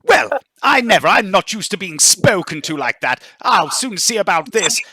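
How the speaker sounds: high, loud huffy indignation; affronted noblewoman. Acted. Noblewoman threatening the rude stranger before stomping off, indignant. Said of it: "And she goes stomping off".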